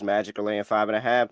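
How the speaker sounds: usual speaking voice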